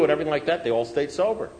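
ordinary talk

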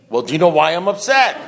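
exasperated retold question, voice lifted; acted, pushed-into-it asking; forced question. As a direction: give in and ask it, pointedly. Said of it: "so he forced me".

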